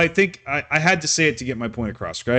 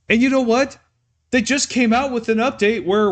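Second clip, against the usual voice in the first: ranting; 6 semitones higher than usual. Real, not acted. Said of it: "I'm ranting, I'm yelling".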